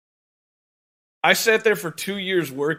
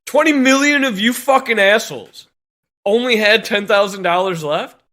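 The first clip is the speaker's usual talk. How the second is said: louder, higher furious jab; angry rant; 4 semitones higher, 5 dB louder than usual. Real, not acted. Plumber ranting at loan borrowers, loud and furious. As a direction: spit it out at the borrowers.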